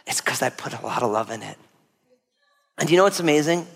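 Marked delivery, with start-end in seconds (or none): 0.1-1.6 low, soft, tender secret; grandma's hushed confiding. Retold or imitated. Grandma telling grandson why her food is good, hushed. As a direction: share it softly, close to the ear. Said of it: "she whispers in my ear".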